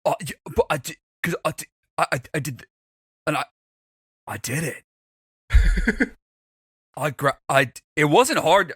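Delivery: halting, stumbling stutter. Acted. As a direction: stammer it out bashfully. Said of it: "Donar stuttering".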